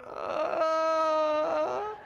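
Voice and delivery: high, drawn-out mock groggy whine; childhood self waking up. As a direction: play a kid making a show of waking up. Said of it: "I rolled over and went".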